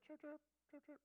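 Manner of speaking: high, breathy mock chirping; half-hearted birdsong; bad bird chirp imitation. Shown as a demonstration. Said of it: "that's not a very good bird".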